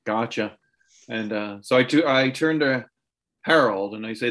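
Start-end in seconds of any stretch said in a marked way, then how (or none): none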